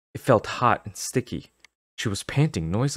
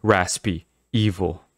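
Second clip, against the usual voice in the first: ordinary delivery, nothing marked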